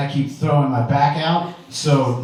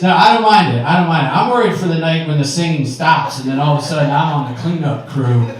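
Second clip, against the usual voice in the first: ordinary delivery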